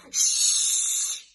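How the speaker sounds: a sharp, loud whispered shush. Acted. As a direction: hush the noisy cats sharply. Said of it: "they all said".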